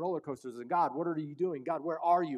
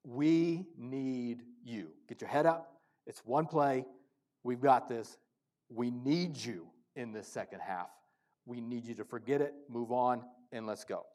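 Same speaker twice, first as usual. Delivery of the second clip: lower, softer coaches reassuring a player; calm them down and lift them up quietly; 5 semitones lower, 5 dB quieter than usual. Retold or imitated. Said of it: "and they said".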